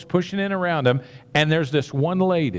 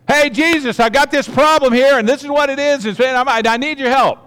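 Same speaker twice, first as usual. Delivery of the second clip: much higher and louder, calling out to Jesus; mock loud announcing; 8 semitones higher, 7 dB louder than usual. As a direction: broadcast your problem to the whole crowd, hammed up. Woman pictured announcing her problem.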